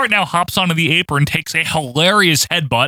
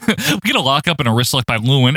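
usual speaking voice

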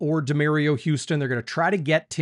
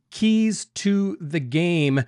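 keys to the game, drawn out like a show segment title; slow, announcing the segment to Chris; slow segment intro; 3 semitones higher, much slower than usual. Shown as a demonstration. Host slowly announcing the segment title. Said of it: "all right, Chris".

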